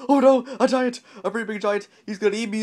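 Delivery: high, loud alarmed squeal; panicked cry. A narrator's reading. Mister Jelly jumping at his neighbor's yell, panicked cry. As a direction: cry out in cartoon terror.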